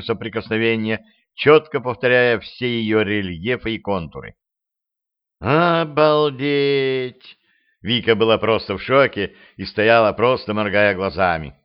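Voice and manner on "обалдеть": slow, drawn-out gasp of awe; stunned amazement. Acted. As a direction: let the wonder stretch out. Vika stunned by the capsules.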